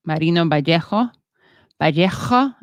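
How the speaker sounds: Vallejo with a Castilian throaty j and rising end; overdone Castilian Vallejo, said twice with a rise; Spain Spanish pronunciation. Shown as a demonstration. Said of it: "overdoing the Spain Spanish".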